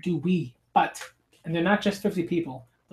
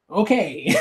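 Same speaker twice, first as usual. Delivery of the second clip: laughing out loud, higher and louder; real laughter; 5 semitones higher, 8 dB louder than usual. Real, not acted. Reader bursting into laughter at the grisly manga scene, laughing. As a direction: crack up mid-reading.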